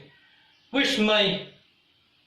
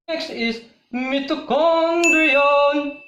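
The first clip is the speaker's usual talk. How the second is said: higher, loud and slow reveal; booming announcement; 7 semitones higher, 6 dB louder, slower than usual. Shown as a demonstration. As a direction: boom out the next topic slowly. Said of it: "loud drawn-out announcement".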